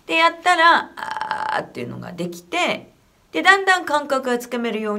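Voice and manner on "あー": low creaky rattle on the vowel; vocal fry. Shown as a demonstration. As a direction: creak it from the throat. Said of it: "fry demo inside sentence".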